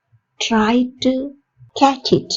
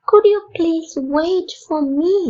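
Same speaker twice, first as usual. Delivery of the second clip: higher, slow, stressed "could"; higher polite repeat; 6 semitones higher, much slower than usual. Shown as a demonstration. Teacher repeating the polite request for learners, higher. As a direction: lift it up and lean on "could". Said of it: "எப்படி கேட்கலாம்?".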